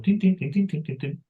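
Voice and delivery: high, light tin tin tin like piano staccato; imitated staccato piano. Shown as a demonstration. Teacher mimics comic silent-film piano staccato. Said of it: "música de piano en estacatos".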